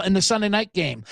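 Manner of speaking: ordinary talk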